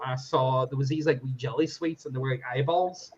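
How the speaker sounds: ordinary talk, nothing marked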